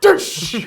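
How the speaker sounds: high, loud punch noise; a cartoon impact sound; punch sound effect.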